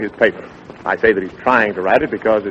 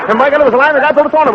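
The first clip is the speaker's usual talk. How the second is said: high, louder rushing narration; excited telling; 14 semitones higher, 5 dB louder, much faster than usual. Real, not acted. Dick tells the climax of his story excitedly. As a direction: keep the pitch up with excitement.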